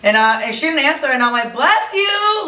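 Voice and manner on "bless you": high, teasing bless you; playful call from the kitchen; jokey bless you. Retold or imitated. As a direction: sing it up high to be funny. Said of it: "like, trying to be funny".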